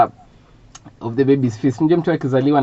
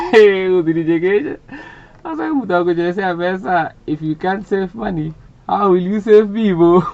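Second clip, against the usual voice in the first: mocking mother; 7 semitones higher, 6 dB louder than usual. Mother to son who wants to be a pastor, mocking disbelief. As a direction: play Mum incredulous, stretching the words out. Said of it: "My mother said".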